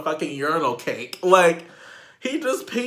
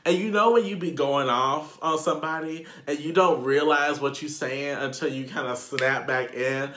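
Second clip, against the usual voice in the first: normal talking voice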